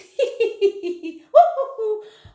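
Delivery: high, loud "hee hee, hoo hoo"; hooting laughter. A narrator's reading. Duckbills watching dad's fall, high hooting laugh. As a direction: hoot with laughter. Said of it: "how the duckbills hooted".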